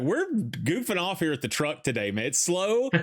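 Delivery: high, playful shout-out to customers; goofy hype voice. Pretend. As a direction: pretend livestream pitch, laid back and silly. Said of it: "Just be like".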